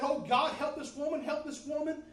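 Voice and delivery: loud, high pleading cry; urgent prayer. Retold or imitated. Preacher acting out his prayer for the woman, urgent pleading. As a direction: call out to God at full volume. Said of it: "I'm just praying. I'm saying".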